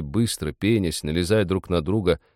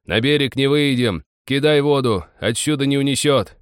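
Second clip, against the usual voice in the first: higher, louder urgent orders; frantic shouting; 6 semitones higher, 4 dB louder than usual. A narrator's reading. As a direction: bellow over the storm.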